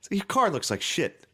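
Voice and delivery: high-pitched, exasperated imitation of an angry father; mad dad. Dad scolding son over wrecked mirror, mock furious. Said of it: "He was so mad".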